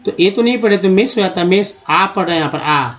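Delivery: ordinary talk, nothing marked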